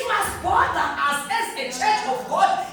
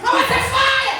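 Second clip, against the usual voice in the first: higher and louder, "fire" flung out; fiery shout; 6 semitones higher, 6 dB louder, slower than usual. Acted. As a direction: call down fire on the congregation. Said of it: "preacher repeats louder".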